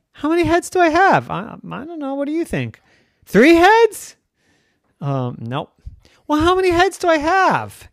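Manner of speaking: high, loud pleading questions; anxious kid. Acted. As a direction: demand to know the answer.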